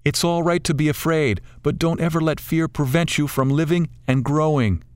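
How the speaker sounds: put-on a kindly old bird coaxing them to enjoy it; wise old bird. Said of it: "to declare".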